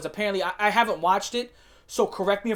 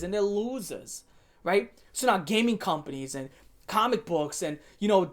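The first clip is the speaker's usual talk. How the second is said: ordinary talk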